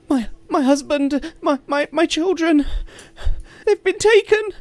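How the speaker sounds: high, loud, stammering plea; a panicked mother begging for help; frantic farmer woman. Acted. Distraught farmer woman begs the party, stammering high and loud.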